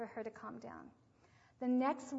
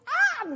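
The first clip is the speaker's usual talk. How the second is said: put-on short high loud baby yelp; imitated baby cry; 15 dB louder than usual. The baby gives one last cry, high loud yelp.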